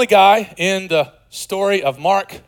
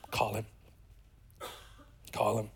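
hushed call; 5 semitones lower, 17 dB quieter than usual. Acted. Jesus calling, hushed.

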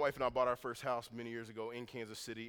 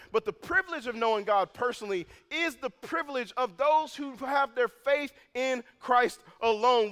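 louder and higher, slowing into a proclamation; fervent preaching; 10 semitones higher, 10 dB louder than usual. Acted. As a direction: preach it out over the room with growing fire. Preaches building to full force.

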